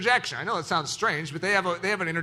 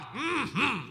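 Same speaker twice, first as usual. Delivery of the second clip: affirming Southern hum; drawn Southern murmur of approval; Southern mm-hmm; 3 semitones higher, 4 dB quieter than usual. Shown as a demonstration. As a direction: hum agreement like a Southern listener. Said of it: "the Southern, mm-hmm".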